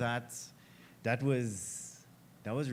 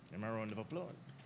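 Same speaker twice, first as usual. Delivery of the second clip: ordinary delivery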